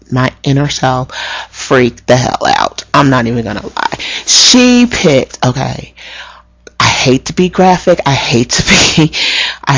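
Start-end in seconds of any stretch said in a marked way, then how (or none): 4.2-5.4 high, loud burst of disgust; disgusted outburst. Real, not acted. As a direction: blurt it out, appalled. Disgust at booger.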